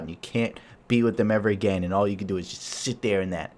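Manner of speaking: usual speaking voice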